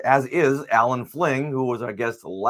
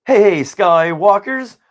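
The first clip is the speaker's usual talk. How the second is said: podcast host greeting; 6 semitones higher, 10 dB louder than usual. Acted.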